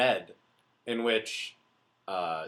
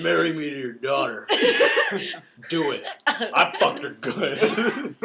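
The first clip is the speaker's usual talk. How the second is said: laughing mock duke; 4 semitones higher, 5 dB louder, slower than usual.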